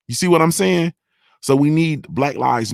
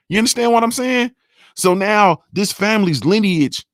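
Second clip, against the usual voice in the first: impassioned, pitch pushed higher; getting upset; 5 semitones higher than usual. Real, not acted. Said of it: "man, I'm getting upset".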